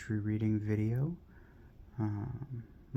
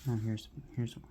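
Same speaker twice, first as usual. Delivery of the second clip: ordinary talk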